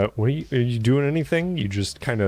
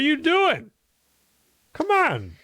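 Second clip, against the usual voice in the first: much higher, baffled and scolding; exasperated NPC; slower than usual. Acted. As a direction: squeak out a caught-you rebuke. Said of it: "and just like".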